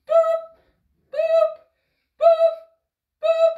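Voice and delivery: very high, loud imitated sonar boops; short hooting submarine pings. Instructor playing submarine with children, high loud boops.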